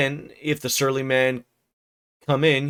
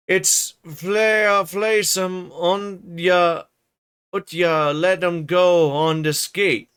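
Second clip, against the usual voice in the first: put-on slow, higher broad rustic northern English accent, outraged; old servant's rural accent; 5 semitones higher, slower than usual. Joseph tells the master of the children's sins in a slow rustic accent. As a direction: drag out the complaint like an affronted old servant. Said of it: "accent he's supposed to have".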